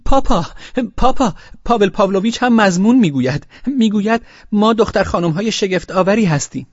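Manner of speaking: put-on high, loud children's cry; little girls shouting. A narrator's reading. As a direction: call out to papa like excited little girls. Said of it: "یک صدا فریاد کشیدند".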